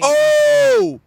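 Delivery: shocked shout. Real, not acted. Shocked by five months pregnant.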